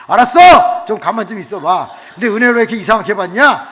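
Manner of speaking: loud, high, exasperated telling-off; flustered scolding. Retold or imitated. Pastor to strangely behaving girl, loud flustered scolding. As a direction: burst out, then hurry through the complaint.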